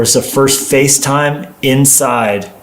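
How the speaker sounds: ordinary delivery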